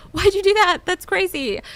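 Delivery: high, loud disbelieving yell; exasperated outburst. Retold or imitated. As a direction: shout it high and loud, flabbergasted. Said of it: "'cause I'd be like".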